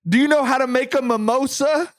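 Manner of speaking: high, mock-stern question; dramatic reading. Reading a tweet aloud, as a high, mock-stern question. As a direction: quiz them like a mock-stern teacher. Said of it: "a dramatic [name] and [name] reading".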